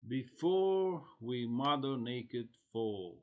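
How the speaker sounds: slow, projected poetry reading; poem recitation. Acted. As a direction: declaim the line with long, drawn-out stresses. Reads poem title aloud.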